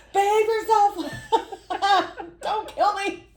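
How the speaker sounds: high, laughing mock plea to the mask; giggling, pleading with a scary mask. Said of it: "I'm like".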